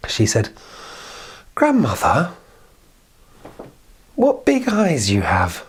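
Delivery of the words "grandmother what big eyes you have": slow, high, wide-eyed question; a lightly voiced girl in awe; wondering child. The girl notices the big eyes, slow and wondering.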